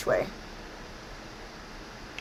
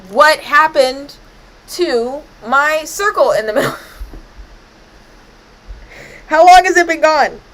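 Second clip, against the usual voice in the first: high, loud alarmed cries; panicking over the vanished circle, loud and high; shocked outburst, high and loud; dramatic panic; 7 semitones higher, 9 dB louder than usual. Real, not acted. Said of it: "That was awful dramatic".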